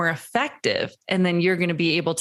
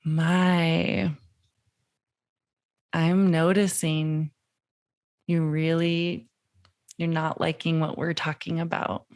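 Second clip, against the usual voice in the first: whoa, low, and slow; 2 semitones lower, slower than usual. Shown as a demonstration. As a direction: ease him down slowly and gently. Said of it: "whoa, low, and slow".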